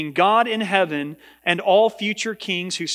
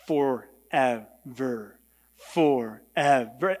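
slow, low, quieter drawn-out deadpan police chief; lowered, solemn movie police chief voice; police chief impression; 6 semitones lower, 4 dB quieter, much slower than usual. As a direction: stretch each forever out like a grave movie cop. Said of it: "I can almost hear the voice of the police chief in The Sandlot".

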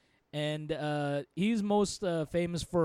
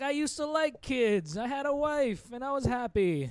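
put-on sad old man; 8 semitones higher than usual. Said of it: "It's like".

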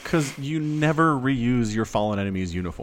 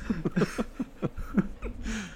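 high giggling laugh; 10 semitones higher, 7 dB quieter than usual. Real, not acted. Laughter after joke.

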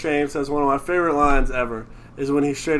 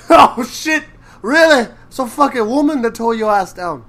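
high, loud surprised gasp; hammed-up surprise; mock surprise; 7 semitones higher, 4 dB louder than usual. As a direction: act out the shock. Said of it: "retold scene dialogue".

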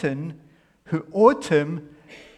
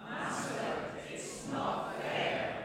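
softer, barely voiced congregation line; too quiet; 12 dB quieter than usual. Real, not acted. Congregation's first try at the line, too soft. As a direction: mumble it, not loud enough yet. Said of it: "they had to speak very loud".

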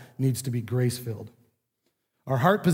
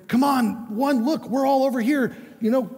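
much higher, calling out to the lost one; coaxing call; 9 semitones higher than usual. Retold or imitated. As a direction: call him back like a crowd urging. Ninety-nine calling the lost one.

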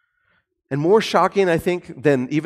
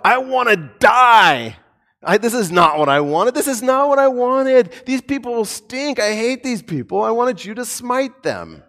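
high, whiny tantrum; worked-up Jonah; 7 semitones higher, 4 dB louder than usual. Retold or imitated. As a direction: throw a petulant fit at God. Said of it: "Jonah, very worked up".

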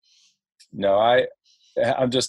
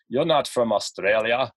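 a hint of an Austrian accent; lightly imitated Austrian-accented challenge; Arnold Schwarzenegger. Little guy challenging a tourist in a light mock Austrian accent. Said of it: "he sounded like a s-, Arnold Schwarzenegger".

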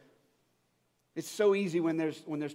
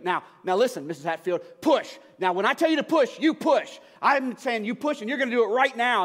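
loud, urgent commands, higher; barking orders; 5 semitones higher, 5 dB louder than usual. Retold or imitated. Doctor snapping orders at a mother in labour, loud urgent bark. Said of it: "barking out orders".

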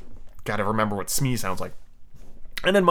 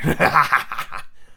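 mirthless villain chuckle; empty staccato laugh; hollow laugh; 3 semitones higher than usual. Acted. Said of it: "but in a hollow way".

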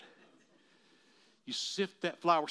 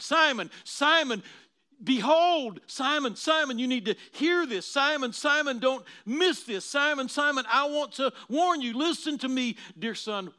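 urgent loud warning; 8 semitones higher, 8 dB louder than usual. Acted. Jesus warning Simon, preached.

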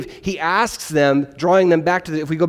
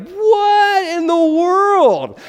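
high, slow, stretched-out disbelief; baffled squeal; 14 semitones higher, 4 dB louder, much slower than usual. Retold or imitated. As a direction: squeak it out like you truly have no clue. Confused reaction to explosion.

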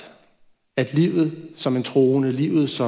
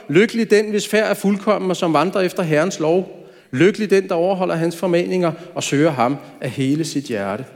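raised, declaiming psalm reading; scripture reading; 4 semitones higher than usual. Acted. Preacher reads the psalm's opening to the congregation, declaiming. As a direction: proclaim the opening verses of the psalm.

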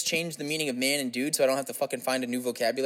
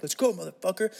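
higher-pitched "come at me" taunt; mock fighting challenge; 7 semitones higher than usual. Acting a guy challenging another to fight.

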